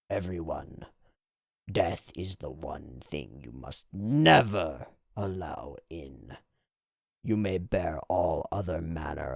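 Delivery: low, soft, slow pronouncement; solemn village elder. Acted. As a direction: intone it low and grave, with long pauses. Village elder character in the manga.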